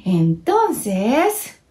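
ordinary delivery, nothing marked

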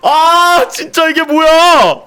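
whiny disbelief. Real, not acted. One hit nearly killed him.

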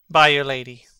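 ordinary delivery

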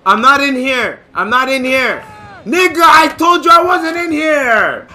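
high, loud, frantic yelling; panicked shout. Real, not acted. To the killer nearby, frantic shouted denial. Denies hiding as killer comes.

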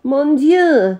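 high, loud French cry; French exclamation. A narrator's reading. The French immigrant laments his grapes, a loud French cry. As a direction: declare it in a French accent. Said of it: "declared the Frenchman".

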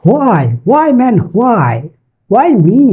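why drawn out high as "hwai"; high, slow why with an audible h; local mispronunciation. Shown as a demonstration. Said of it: "Why বলতে কিছু নেই". (why-তে টানা হ ধ্বনি, উঁচু স্বরে)

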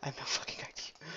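breathy whisper aside. Real, not acted. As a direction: whisper it to yourself.

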